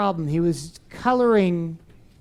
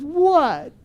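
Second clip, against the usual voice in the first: put-on upset boy; 8 semitones higher than usual. Upset boy answers teacher.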